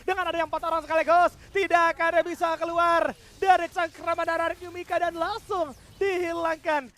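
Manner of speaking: loud hyped shoutcast call; fighting game announcer. Real, not acted. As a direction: belt out the kill call. Caster calls a teamfight wipe. (teriakan komentator esports)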